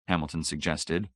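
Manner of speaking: plain reading voice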